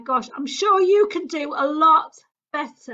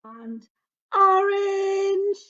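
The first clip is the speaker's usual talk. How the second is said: ordinary delivery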